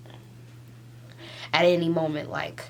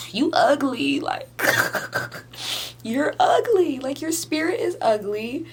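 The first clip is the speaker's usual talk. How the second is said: higher and louder through real tears; real crying, much higher and louder; 7 semitones higher, 5 dB louder than usual. Real, not acted. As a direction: cry it out, voice pitched up and breaking. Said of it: "I'm face masking while I'm crying".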